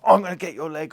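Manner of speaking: a touch of a threatening snap; mock harsh tone. Imitating the trainer getting harsh with the horse. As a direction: pretend to turn rough on him. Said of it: "changing the tone of my voice".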